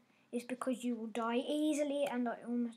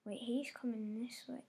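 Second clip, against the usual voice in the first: whisper; 9 dB quieter than usual. Real, not acted. Sneaking past an enemy in game.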